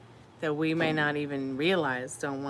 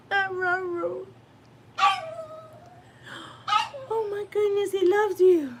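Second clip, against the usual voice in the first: very high, loud squealing at the dog; delighted squeal; 6 dB louder, slower than usual. Real, not acted.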